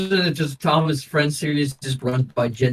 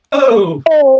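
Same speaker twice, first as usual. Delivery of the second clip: loud, higher mock-Italian exclamations; mock Italian outburst; 7 semitones higher, 10 dB louder than usual. Imitating indignant Italians, loud mock outburst. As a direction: cry out like a hammed-up Italian. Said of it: "favorite about the Italians".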